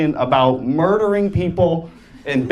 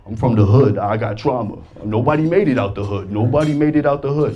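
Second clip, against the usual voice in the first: rapper; 7 semitones lower than usual. Retold or imitated. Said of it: "rappers be talking about".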